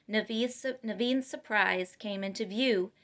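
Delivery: ordinary delivery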